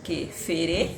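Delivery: French with a Portuguese-tinged accent; non-native French accent, Lusophone lilt; foreign accent in french. Real, not acted. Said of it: "Et pardon mon français".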